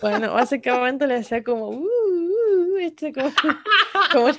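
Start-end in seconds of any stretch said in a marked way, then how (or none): 1.7-2.8 high showy dodging noises; teasing high-pitched hoots while dodging; taunting "uh, uh". Acted. Showing how Aang showed off to his opponent, mock taunting oohs. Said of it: "¿cómo se floreaba Aang?".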